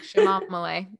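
Chamomile said sha-MOM-ih-lay, stress on MOM; teasing Chamomile as sha-MOM-ih-lay; playful chamomile. Shown as a demonstration. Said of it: "I like saying chamomile".

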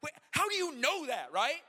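high, loud astonished question; hammed-up disbelief; incredulous. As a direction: demand to know, in comic amazement. Amazed at wife's understanding.

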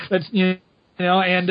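normal talking voice

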